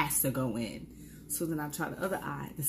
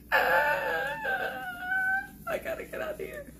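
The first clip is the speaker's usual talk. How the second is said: much higher, near-crying wail; high, panicky whimpering cry; panicked cry; slower than usual. Retold or imitated. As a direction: flee in panic, almost crying. Panicking alone on street.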